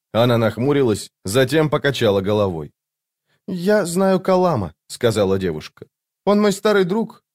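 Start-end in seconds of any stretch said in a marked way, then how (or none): 3.5-4.7 put-on high, slow young woman's voice; slow girlish statement, pitched high; girl character's voice. A narrator's reading. Narrator voices the dazed girl. 6.3-7.2 put-on high-pitched young woman's voice; high girlish statement; girl character's voice. A narrator's reading. Narrator voices the dazed girl.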